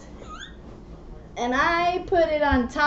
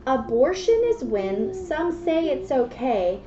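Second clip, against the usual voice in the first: put-on reading to children; 5 dB quieter than usual. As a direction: read the rhymes gently to a child. Reads picture book aloud.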